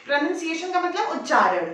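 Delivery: ordinary delivery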